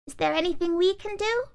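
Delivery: high, hopeful doll. Acted. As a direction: ask it eagerly, high. Doll speaks.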